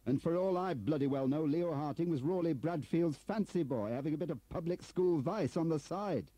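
high, loud, building tirade; furious accusation. Acted. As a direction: hurl the accusation at your superior. Turner flings accusations at Bradfield.

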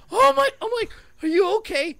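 high, loud flustered alarm; horrified and worried, voice shooting up; panicked concern. Retold or imitated. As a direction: blurt it in high-pitched panic. Retelling panic after hitting the child.

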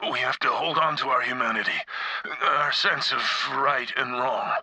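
ordinary talk

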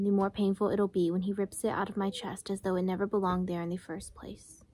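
soft, fast reading from the book, almost whispered; quiet reading aloud. A narrator's reading. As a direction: read the passage under your breath, quickly.

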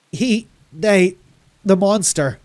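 high, loud, broken fragments; exhausted, shocked rambling. A narrator's reading. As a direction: stammer out pieces through pain and exhaustion. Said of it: "I rambled, done in by pain and exhaustion".

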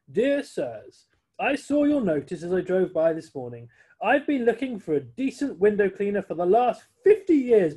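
loud, high, grand reading voice; reading a formal letter. Acted. Chauffeur reads the Duke's letter aloud, grand. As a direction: read it like a proclamation.

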